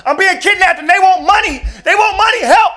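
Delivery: high, loud frantic cry for help; panicked scream. Retold or imitated. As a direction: scream for your dad in terror.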